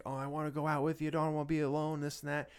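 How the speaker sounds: a light imitation of a pleading little girl; child's plea. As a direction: beg like a small child. Voicing the young daughter.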